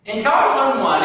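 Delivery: normal talking voice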